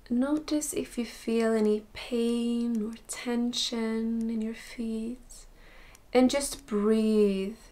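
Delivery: soft, unhurried meditation voice with long pauses; guided meditation. Acted. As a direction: gently guide them through a body scan, slowly and softly. Leads body scan meditation.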